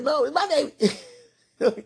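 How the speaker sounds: high, slow, stretched protest; cling to the baby, drawn out; possessive mother. Retold or imitated. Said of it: "I was like".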